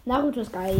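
ordinary delivery

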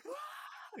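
very high cartoon scream; Patrick scream. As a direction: shriek like losing a video game. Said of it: "He's like".

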